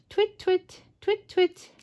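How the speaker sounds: high, light twit-twit like a little bird; starling chirp imitation. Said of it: "I'm not very good at bird sounds".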